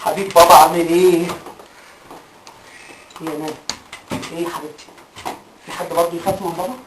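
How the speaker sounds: put-on soft, hushed words to a scared child; gentle coaxing. Father softly coaxes his frightened daughter, hushed and tender. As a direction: coax her tenderly, barely above a breath.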